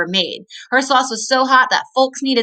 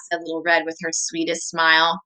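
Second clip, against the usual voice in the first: normal talking voice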